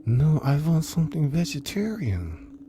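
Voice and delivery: high, insistent mother voice; high-pitched protesting mother; mother refusing gravy. Acted.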